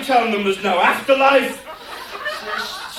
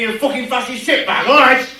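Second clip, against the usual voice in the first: sudden angry outburst; 4 semitones higher, 7 dB louder than usual. Acted. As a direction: snap into a loud rant at the audience. Sudden abuse at audience.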